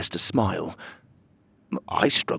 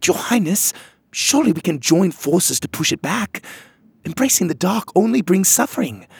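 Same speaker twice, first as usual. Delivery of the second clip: high earnest plea; pleading fairy; 9 semitones higher than usual. Acted. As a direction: plead with the queen, voice raised high. Em pleads with queen.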